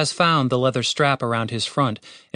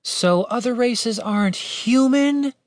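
high, slow, probing question; suspicious; 11 semitones higher, slower than usual. A narrator's reading. Woman querying what races means, suspicious. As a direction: stretch it out and lift into the question. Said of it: "Catherine asked suspiciously".

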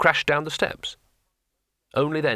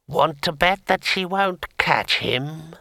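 slow, higher, sepulchral ghost; voice of the grave; 4 semitones higher, much slower than usual. A narrator's reading. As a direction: taunt him as a drawn-out dead voice. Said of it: "in a voice of worms and sepulchres".

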